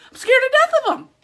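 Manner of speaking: real fear of spiders, declared loud; 1 emphatic declaration. Real, not acted. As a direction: blurt it out loud and high. Ramping up about spiders.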